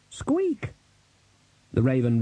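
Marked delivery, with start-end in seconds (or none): 0.1-0.7 tiny, very high-pitched squeak; rat squeak. Acted. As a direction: squeak like a little skeletal rat. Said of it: "The Death of Rats squeaked".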